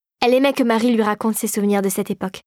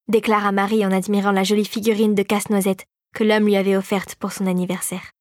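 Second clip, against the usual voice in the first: usual speaking voice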